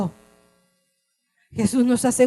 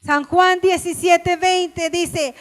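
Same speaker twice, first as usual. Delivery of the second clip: impassioned preaching; 8 semitones higher, 7 dB louder than usual. Acted. Preacher describing Jesus praying for the world, loud fervent preaching. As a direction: cry it out to the congregation.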